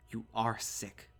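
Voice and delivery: slow, deliberate loathing; full of hatred. A narrator's reading. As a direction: weigh each word with disgust. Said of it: "never heard Dad speak with so much hatred".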